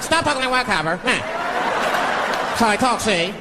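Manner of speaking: put-on high old-movie gangster voice; forties bad guy. Driver answering police who pulled him over, forties gangster. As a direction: sass back like a forties movie crook. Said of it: "how bad guys used to talk".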